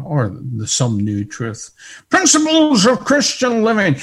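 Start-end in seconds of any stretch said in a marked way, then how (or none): 2.1-4.0 louder, higher mocking preacher voice. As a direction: parody a slick preacher announcing his theme. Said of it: "here's what the preacher says".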